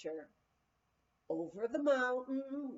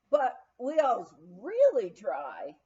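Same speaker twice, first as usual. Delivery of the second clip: Texan insisting; 8 dB louder, slower than usual. Retold or imitated. Spokesman insists.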